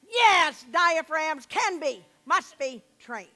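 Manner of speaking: ordinary delivery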